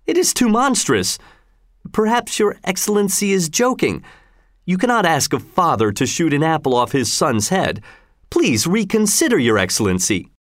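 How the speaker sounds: crying out in protest. A narrator's reading. Father begging the governor to reconsider, understated pleading. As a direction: appeal to him, a touch desperate. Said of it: "cried Tell".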